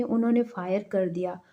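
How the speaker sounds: ordinary delivery, nothing marked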